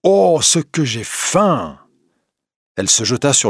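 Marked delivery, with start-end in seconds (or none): 0.0-1.8 high, slow, hungry cry; hungry exclamation. Acted. Wife bursting in, starving, high slow cry. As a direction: let the hunger burst out as she enters.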